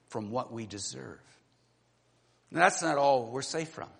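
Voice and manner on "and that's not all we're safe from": ordinary delivery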